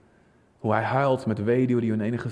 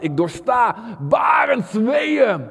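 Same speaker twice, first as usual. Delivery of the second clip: cry of labour pains; 10 semitones higher, 5 dB louder, much slower than usual. Acted. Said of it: "er staat".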